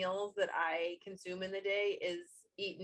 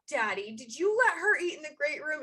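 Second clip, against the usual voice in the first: loud, high mock outrage; making big deal; 6 semitones higher, 9 dB louder than usual. Mom teasingly confronting dad over the great room, mock-outraged. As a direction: exclaim it as a playful, hammed-up accusation. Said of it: "I make a big deal about".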